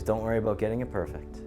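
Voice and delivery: usual speaking voice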